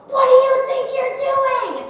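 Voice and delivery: loud, high, drawn-out acted yell; slow, furious full-voiced shout; screaming confrontation. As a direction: imitate an enraged man yelling in someone's face. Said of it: "again started screaming at me".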